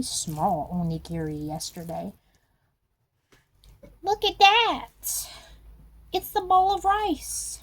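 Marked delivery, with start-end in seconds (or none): 4.0-7.6 much higher, loud and slow; hammed-up excited exclaim.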